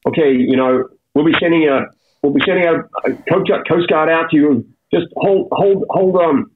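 emergency operator. Retold or imitated. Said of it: "And he goes".

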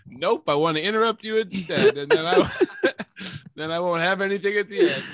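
laughing. Real, not acted. Host teasing his friend about interrupting, laughing. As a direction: talk through real chuckles that break the sentence.

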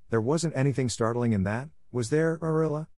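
plain reading voice

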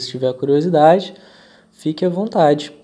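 ordinary talk, nothing marked

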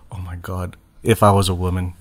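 hushed, low swoon. Retold or imitated. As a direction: breathe it out, lovestruck and low. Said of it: "I'm like".

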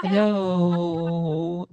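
doubled voice effect; chorus effect. Real, not acted. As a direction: sound like two of you at once. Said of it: "that sounds really chorusy and double myself".

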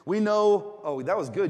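ordinary delivery

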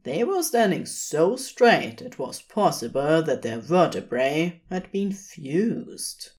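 ordinary delivery

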